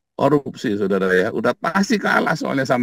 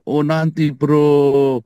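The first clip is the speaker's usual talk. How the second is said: loud, higher, drawn-out excuse; mock excuse-maker; 4 semitones higher, 6 dB louder, much slower than usual. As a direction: stall with exaggerated, slow reluctance. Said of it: "Yuk kita pergi".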